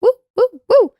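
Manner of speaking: high hoarse woo breaking off; cracking cheer. Real, not acted. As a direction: cheer with a voice that won't hold. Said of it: "I can't do a full woo".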